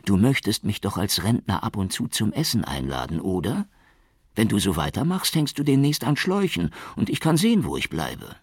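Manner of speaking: lightly voiced secretary. A narrator's reading. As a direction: nag him kindly. Frau Malik voiced.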